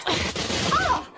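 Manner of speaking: sharp shouted cry as the shock hits; pained shout. Acted. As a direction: yell through the jolt. Straining against an electric shock.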